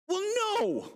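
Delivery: very high, hammed-up admission; comic obvious denial. Rhoda admitting she left Peter outside, comically.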